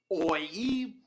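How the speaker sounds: usual speaking voice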